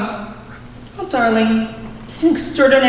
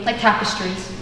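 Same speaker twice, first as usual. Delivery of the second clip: ordinary talk, nothing marked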